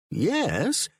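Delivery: a refined English gentleman's reply; English accent. A narrator's reading. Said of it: "in a sophisticated English accent".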